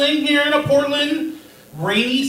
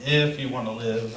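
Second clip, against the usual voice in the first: low, soft and pondering; low muttered repeat; 10 semitones lower, 8 dB quieter than usual. Real, not acted. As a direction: mutter it back to yourself. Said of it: "repeats doctor's line low".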